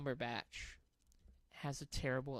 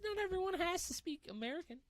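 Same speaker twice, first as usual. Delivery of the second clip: worked up; 12 semitones higher, faster than usual. Real, not acted. Heated rant about accents.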